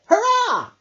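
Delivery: high, loud joyful cheer; cheering hurrah. Acted. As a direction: cheer for happy endings. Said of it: "hurrahed Hedgehog".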